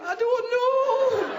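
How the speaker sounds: very high, drawn-out wail; hammed-up wailing girlfriend; distraught woman. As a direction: stretch it into a loud helpless wail.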